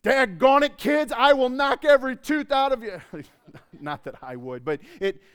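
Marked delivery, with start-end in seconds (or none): 0.0-3.0 higher, louder angry dad yell. Retold or imitated. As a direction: blow up at the kids at bedtime. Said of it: "I raise my voice, the dad voice".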